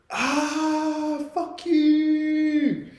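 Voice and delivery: high, drawn-out yell; scream into the night. Retold or imitated. Friend venting at the night sky in the bush, screaming. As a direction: scream it out long into the darkness. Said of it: "decides just to scream out into the night".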